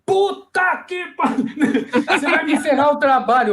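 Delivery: palavrão esticado e bem mais agudo, em tom de desespero cômico; diretor exasperado. Retold or imitated. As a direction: imitar um diretor inconformado, com a voz lá em cima. Diretor reage, exasperado, ao dublador novato. (exasperado, indignado)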